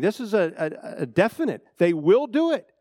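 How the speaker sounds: ordinary delivery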